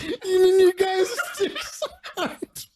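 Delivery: high-pitched quote breaking up with laughter; helpless real laughter. Real, not acted. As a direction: barely get the words out for laughing. Retold question while cracking up.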